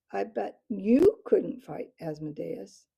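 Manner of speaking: loud, daring call upward; challenging yell. A narrator's reading. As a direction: dare the owl. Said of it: "Matthias yelled up challengingly".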